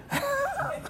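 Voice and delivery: breathy high giggle; real laugh fading; soft high laugh. Real, not acted. Laughs after joke.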